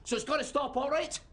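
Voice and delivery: high-pitched, worked-up parental outburst; angry shouting. Acted. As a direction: let the fright burst out as scolding. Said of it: "I didn't mean to shout".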